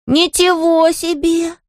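put-on high, drawn-out little boy's wow; admiring exclamation. A narrator's reading. Small boy sees bed lifted, exclaiming in admiration. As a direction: marvel slowly in a tiny child's voice. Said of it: "воскликнул восхищённо Гардар".

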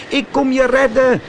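high puppet voice calling across the sea; calling out to father. A narrator's reading. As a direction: shout encouragement to the drowning father. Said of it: "riep Pinocchio".

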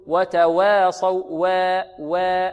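وتواصوا with waa bent into a long "wee/weh"; over-thin the وا in وتواصوا until it becomes e; imala error. Retold or imitated. Teacher imitating students' mistake, tilted vowel in وتواصوا. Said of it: "هذا تقليل أو إمالة كبرى".

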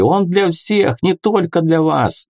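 high-pitched protest; indignant call from the mob; shout from crowd. A narrator's reading. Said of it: "выкрикнул кто-то из толпы".

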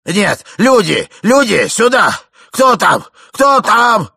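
loud, high alarmed shouts; old man shouting. A narrator's reading. Old man looking under bed, alarmed shouting. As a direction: yell for help, pushing pitch up. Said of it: "закричал старик".

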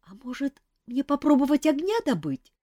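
high, hesitant chick voice; unsure suggestion. A narrator's reading. Chick Mafei unsure offers to make fire, high hesitant. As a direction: offer it timidly. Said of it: "неуверенно предложил Мафей".

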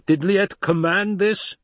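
high, slow challenging question; challenger from above. Acted. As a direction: question the leader's authority, pitched high.

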